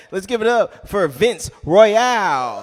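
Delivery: loud, slow, drawn-out headliner introduction; hype announcer call. Real, not acted. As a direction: bring the next act on with a big stretched call.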